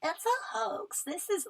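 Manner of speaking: high-pitched slow parody of a denier; mocking quote. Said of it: "there's people out there who are like".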